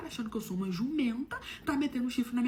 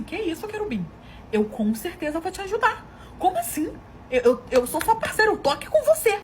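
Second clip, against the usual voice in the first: loud, high-pitched show of support; eager reassurance; 9 semitones higher, 10 dB louder than usual. Retold or imitated. Devoted wife promising to help her husband, eagerly reassuring. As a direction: act out gushing, wholehearted loyalty. Esposa garante apoio ao marido.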